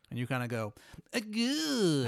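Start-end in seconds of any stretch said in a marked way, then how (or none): 1.1-2.1 high, drawn-out awkward "good"; awkward high reply. Acted. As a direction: squeeze out an uneasy high reply. Awkward reply before walking away.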